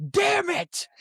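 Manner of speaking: high, exploding mock outburst; pissed-off shout. Movie character furious at forgetting the magazine, mock angry shout. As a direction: shout it like you're genuinely pissed. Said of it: "genuinely pissed".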